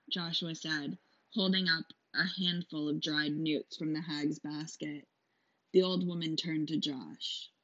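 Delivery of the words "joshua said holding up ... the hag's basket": plain reading voice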